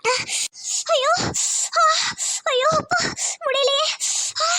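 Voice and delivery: gasps and small moans of pain; burning mouth agony. Acted. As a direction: gasp and whimper through the chilli burn. Alone, suffering from chilli burn. (காரம் தாங்காமல் முனகல்)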